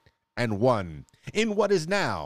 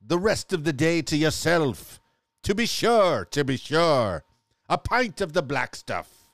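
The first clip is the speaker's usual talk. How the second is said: mock Irish accent; 4 semitones higher than usual. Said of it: "Now I'm starting to sound like a pirate".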